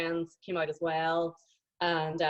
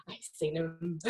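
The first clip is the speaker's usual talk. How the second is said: hushed whisper of discovery; whispered, holding her breath; 6 dB quieter than usual. Retold or imitated. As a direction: breathe it out like a secret. Spotting him at the airport.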